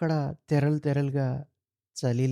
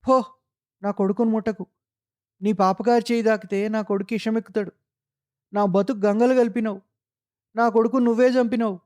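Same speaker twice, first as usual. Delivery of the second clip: higher and louder accusing cry at the man; screaming; 7 semitones higher, 4 dB louder than usual. Acted. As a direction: shriek it out in terror and rage, higher and louder. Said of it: "అరిచింది". (భయంతో కోపంగా పెద్దగా అరుపు)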